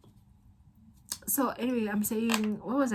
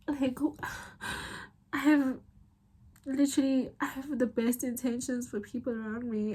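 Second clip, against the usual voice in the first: higher, strained by real upset; emotional, near tears; 5 semitones higher than usual. Real, not acted. Confiding about unreturned love, emotional and near tears. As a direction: plead your case while holding back tears. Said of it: "the fourth time I'm crying today".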